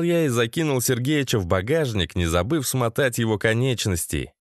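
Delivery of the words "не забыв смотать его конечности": plain reading voice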